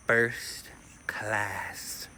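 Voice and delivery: whispered reveal; hushed whisper. Real, not acted. Traveler reveals his upgrade to first class, whispered. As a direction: drop to a whisper to savor it.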